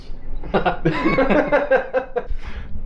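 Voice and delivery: high burst of real laughter; long laugh. Real, not acted. Host amused by nauseous viewers, laughing. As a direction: crack up at a joke.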